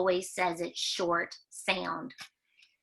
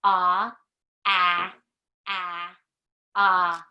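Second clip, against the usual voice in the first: loud short vowel chant, much louder; alien talk; 5 dB louder, much slower than usual. Pretend.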